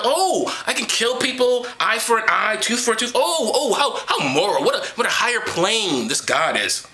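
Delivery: put-on high, wide-eyed naive kid voice, laughing; mock awed kid. Mocking a child reader, high naive gushing. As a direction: gush like a dim child discovering morals. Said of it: "they read this and go".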